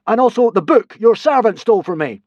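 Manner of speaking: loud, high, commanding voice; god's cry. A narrator's reading. Hiccup as a god demanding the stolen book. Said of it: "cried Hiccup".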